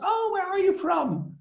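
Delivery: high, slow, gushing park employee; exaggerated high-pitched staff greeting; Disney employee. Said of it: "every Disney employee always asks".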